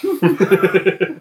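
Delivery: giggling burst of laughter. Real, not acted. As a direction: laugh at the silly joke. Group joking over milking snakes.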